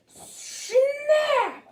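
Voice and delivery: sharp, loud, high "snap!" like a trap; snap sound. A narrator's reading. Trap springs shut in story.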